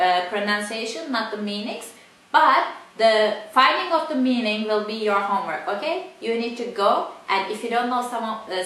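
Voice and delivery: ordinary talk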